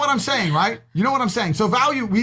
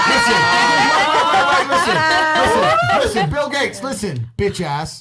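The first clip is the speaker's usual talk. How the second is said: laughing taunt; 10 semitones higher, 3 dB louder than usual. Real, not acted. Laughing mock address.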